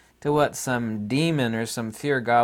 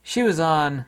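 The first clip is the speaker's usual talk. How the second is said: ordinary talk, nothing marked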